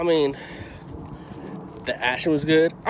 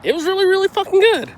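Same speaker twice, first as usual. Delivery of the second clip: excited; 6 dB louder than usual. Real, not acted. Reviewer gushing about the movie, loud and high. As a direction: pitch jumps high and loud on really fucking good.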